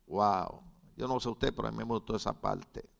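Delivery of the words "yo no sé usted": usual speaking voice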